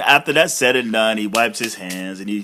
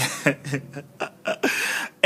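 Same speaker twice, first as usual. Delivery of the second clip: breathy laugh; 7 dB quieter than usual. Real, not acted. Laughs at own prediction.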